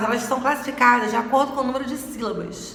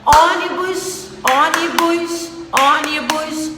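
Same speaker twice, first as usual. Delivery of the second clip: "ônibus" slow and high, weight on Ô; stress on first syllable; 4 semitones higher, 3 dB louder, slower than usual. Shown as a demonstration. Teacher repeating to find the tonic syllable, "ônibus" stressed on Ô. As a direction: lean on the ô of "ônibus", pitch raised. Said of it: "O on, que é a sílaba tônica".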